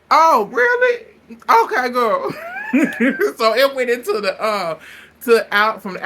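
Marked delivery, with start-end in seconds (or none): 0.1-2.3 high, loud, sarcastic yell; sarcastic shout. Retold or imitated. Retelling heated fight, shouting back. 2.4-3.3 high squealing cackle; squealing laugh. Real, not acted. Laughing at the fight.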